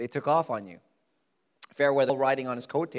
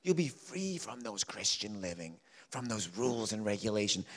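sin whispering a lure, much lower and softer; tempting voice of sin; 4 semitones lower, 6 dB quieter than usual. Acted. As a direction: coax like a temptation, low and quiet. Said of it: "Sin promises freedom".

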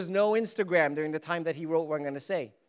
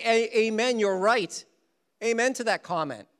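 loud, hearty mock agreement; a louder hammed-up amen; 7 semitones higher, 6 dB louder than usual. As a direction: ham up a cheery amen.

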